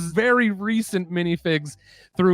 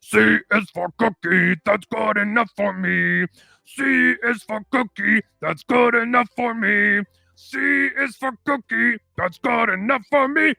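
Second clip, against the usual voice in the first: growl-sing it hungry; Cookie Monster; 4 semitones higher than usual. Retold or imitated. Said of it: "Cookie Monster comes in".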